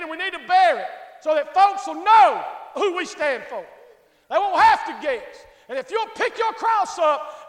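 loud, driving sermon delivery; full-force preaching. Real, not acted. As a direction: preach it loud, hammering the key words.

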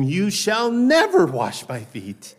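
indignant protest, stress on "never"; righteous indignation. Retold or imitated. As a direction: Peter refusing in mock outrage. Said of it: "Righteous igni-, indignation".

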